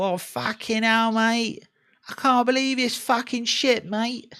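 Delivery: high-pitched put-on London accent. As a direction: do an exaggerated moaning Londoner. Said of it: "like, a London accent".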